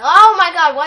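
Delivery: high, very loud oh my god; startled shriek. Real, not acted. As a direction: yelp it in a sudden fright. Spider suddenly next to house.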